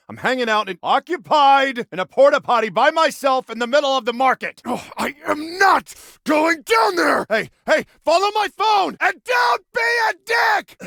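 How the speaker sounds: high, strained, irate outburst; indignant and loud; exasperated yelling. Acted. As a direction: shout it fed up into the phone.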